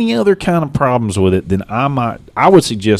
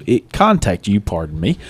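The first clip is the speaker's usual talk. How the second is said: usual speaking voice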